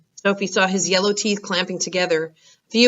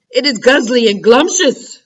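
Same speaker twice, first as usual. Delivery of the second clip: louder giant shout; 4 semitones higher, 6 dB louder than usual. Acted. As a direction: shout it back greedily. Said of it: "shouted the Blood Bottler".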